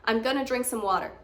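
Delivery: quick "gonna", light R on "water"; American reduced, fast. Shown as a demonstration. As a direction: blend it fast American style. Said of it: "It's a bit quicker".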